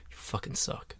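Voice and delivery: breathy hushed insult; whispered put-down, half joking; mock whisper. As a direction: hiss it under your breath as the girlfriend. Acted girlfriend quote, voiceless.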